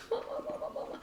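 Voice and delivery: breathy, voiceless cat pleading; gasp, then whisper like a clingy cat calling for its mother; tiny whispered cat voice; needy cat. Acted. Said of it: "And he's like".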